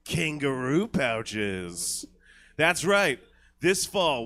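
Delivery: dramatic trailer voiceover; trailer narration. Acted. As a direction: narrate like a gritty action trailer.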